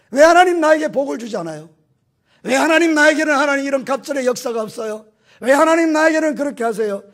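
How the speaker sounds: más agudo y fuerte, reclamando a Dios; queja predicada. Acted. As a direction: clama como creyente ofendido. Queja del creyente, predicando.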